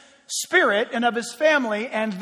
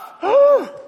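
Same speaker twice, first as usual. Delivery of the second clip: sharp high theatrical gasp; mock gasp; 11 semitones higher, 5 dB louder than usual. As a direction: gasp as if scandalized. Mock shock at banning music.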